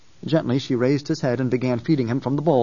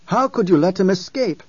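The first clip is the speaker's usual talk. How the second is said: loud, higher furious demand; raging; 6 semitones higher, 4 dB louder than usual. A narrator's reading. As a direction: rage at them for the escape. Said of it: "Halleck raged".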